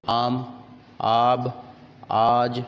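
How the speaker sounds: opening vowel of आम, आब, आज held long. Shown as a demonstration. Teacher showing learners the alif with madd, आम, आब, आज drawn out long. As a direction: stretch the first "aa" in आम, आब, आज. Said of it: "उसे लंबा करके पढ़ा जाता है".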